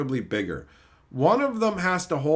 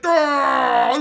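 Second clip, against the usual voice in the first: short loud brute growl; mock raider roar; 7 semitones higher, 6 dB louder than usual. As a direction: growl like a roid-raging raider. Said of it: "he was just like".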